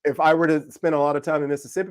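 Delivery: normal talking voice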